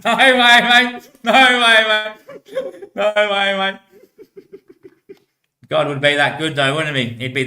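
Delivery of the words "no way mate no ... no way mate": high, loud words through real laughter; helpless high-pitched laughing; laughing disbelief. Real, not acted. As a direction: crack up while exclaiming. Laughing disbelief at imagined scene.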